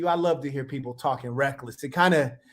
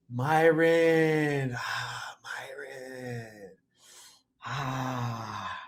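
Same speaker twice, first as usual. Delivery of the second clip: dismayed; 4 semitones lower, much slower than usual. Real, not acted. Reacting to Myron's comment, dismayed and fading.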